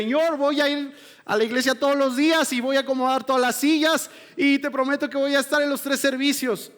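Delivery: high, loud, rushed pleading vow; eager promise. Acted. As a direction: overdo the guilty promises to God. Mimics an over-eager repentant promise.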